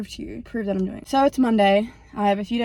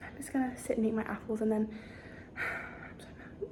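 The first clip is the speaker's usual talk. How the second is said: angry whisper; 12 dB quieter than usual. Real, not acted. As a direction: hiss the frustration out quietly. Trying not to get mad.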